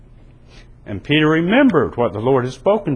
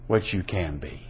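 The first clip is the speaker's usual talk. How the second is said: hushed appeal; 9 semitones lower, 9 dB quieter than usual. Real, not acted.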